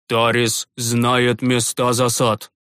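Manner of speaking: slow, grave priest's voice; the priest grimly concludes, slow and low; grim summary. Acted. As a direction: sum it up darkly and slowly. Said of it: "мрачно подытожил".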